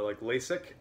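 ordinary talk, nothing marked

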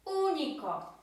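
único with the Ú punched high and loud; único, first syllable stressed. Shown as a demonstration. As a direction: lean hard on the opening ú of único, then fall. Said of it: "Akcent w wymowie pada na to U".

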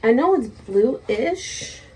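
ordinary delivery

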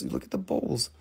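hushed whisper; whispered aside about the bowls. Real, not acted. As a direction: breathe it to the camera. Aside while showing crystal.

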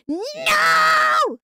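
high, drawn-out mock shout; comic wail of protest; retold refusal. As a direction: yell a long exaggerated no. Acted reply to Sir reading ingredients.

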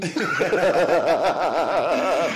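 loud, high burst of laughter; hearty laugh. Real, not acted. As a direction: laugh it off loudly. Guest laughs at own joke.

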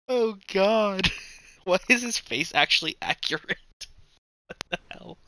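laughing through the words; laughing disbelief. Real, not acted. Gamer laughing at an accurate player face, laughing. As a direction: giggle out the question.

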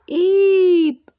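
very high sharp squirrel squeak. A narrator's reading. Squirrel frightened mid-swing, high squeak. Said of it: "squeaked the squirrel".

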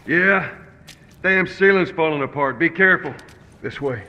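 loud, urgent call to a partner; calling out warning. Acted. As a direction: call back loudly through falling debris. Calling back as the ceiling collapses.

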